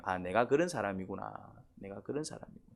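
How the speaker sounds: hushed self-discovery. Retold or imitated. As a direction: say it like it just dawned on you. Self-realization, slow and soft.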